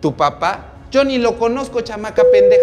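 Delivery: high, loud retort climbing to a shriek; indignant yelling. Retold or imitated. Roselena denies knowing Paola's father, indignant yelling. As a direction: act out an outraged comeback. Acted quarrel, Roselena snaps back.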